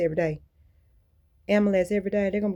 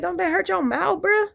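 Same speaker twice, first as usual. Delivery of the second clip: much higher incredulous question; retold disbelief at dogs chewing wire; 11 semitones higher, 4 dB louder than usual. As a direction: ask it high, baffled. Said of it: "I'm like".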